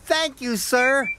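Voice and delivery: high, delighted thanks; overjoyed Percy. A narrator's reading. Percy thanking Sir Topham Hatt, overjoyed. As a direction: burst with joy in a high engine voice.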